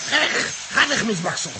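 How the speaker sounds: high, loud frantic shout; loud shrill panic shouting. Acted. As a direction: chase the rat off with a yell. Shooing the rat.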